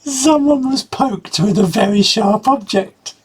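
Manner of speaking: high, prissy mock of the euphemism; mocking officer's line. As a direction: squeak it out in disbelief.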